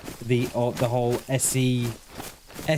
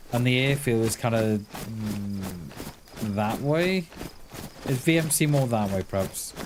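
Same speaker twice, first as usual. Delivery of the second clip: ordinary talk, nothing marked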